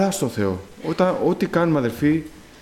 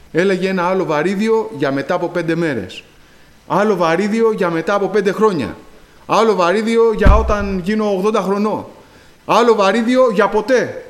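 rising louder and higher with each weight; building preaching; 5 semitones higher, 4 dB louder than usual. Acted. Preacher tells the backpack story, building to a loud climax.

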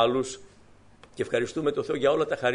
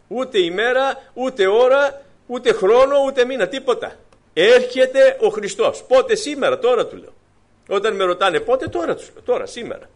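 fiery preaching; 9 semitones higher, 7 dB louder than usual. Acted. Preacher tells the brethren Christ is coming now, fiery preaching. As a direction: proclaim it to the congregation at full force.

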